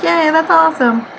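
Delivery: high, excited mock cheer; excited kid. [name] reacting to the big backyard, excited child. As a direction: cheer brightly like a delighted child, lightly acted.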